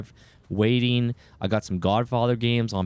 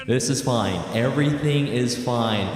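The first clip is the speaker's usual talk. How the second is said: normal talking voice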